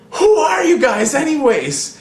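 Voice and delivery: high, cracking mock shout of a bewildered kid; mock shrill yell. Imitating a kid in the brawl yelling his question, mock shrill shout. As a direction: yelp it out like a confused kid. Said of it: "this kid going".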